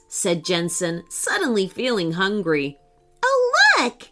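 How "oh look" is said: high, loud, eager squeal; a kitten spotting treats and rushing over; excited cry. A narrator's reading. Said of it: "rushing up to a nearby plant".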